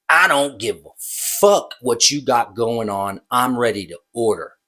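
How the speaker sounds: ordinary talk, nothing marked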